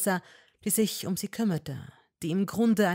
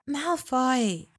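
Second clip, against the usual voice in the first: higher, calling his name; Hermine voice; 8 semitones higher, 5 dB louder than usual. A narrator's reading. Hermine calling Malfoy. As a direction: call to him.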